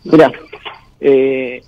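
ordinary talk

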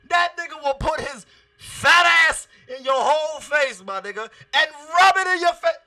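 loud, hyped shout about the wrestler's finisher; excited shout. Real, not acted. Hyping up a fan over Rikishi's finisher, excited shout. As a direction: yell it with full excitement.

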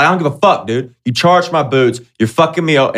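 mock-furious shout at the shop guy; partly acted irate yell; retold yelling. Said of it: "just yelling about shit".

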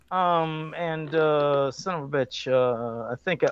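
ordinary talk

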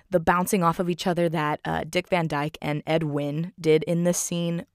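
ordinary delivery

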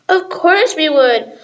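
mild excited yell. Acted. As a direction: friends accept the tour with glee. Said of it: "we all yelled in excitement".

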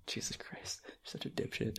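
very soft, voiceless whisper; whispered aside. Acted. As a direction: whisper it under your breath after a laugh. Muttered aside after the caller's remark.

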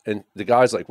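ordinary talk, nothing marked

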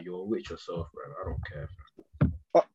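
ordinary talk

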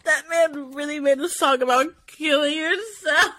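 ordinary talk

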